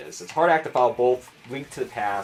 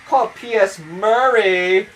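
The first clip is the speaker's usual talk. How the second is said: hammed-up marketing pitch; 9 semitones higher, 9 dB louder, slower than usual. Mock marketing exec pitch.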